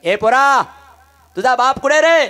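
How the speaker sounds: put-on loud, high, bossy moneylender calling the boy; arrogant moneylender. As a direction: bark at the child like a rich lender. (उद्धट सावकाराचा मोठा आवाज)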